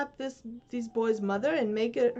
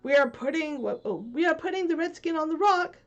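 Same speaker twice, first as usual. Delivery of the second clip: loud, higher pirate call across the water; 7 semitones higher, 8 dB louder than usual. Acted. Smee calling back to his captain across the water. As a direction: shout it over the lagoon. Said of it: "Smee called out".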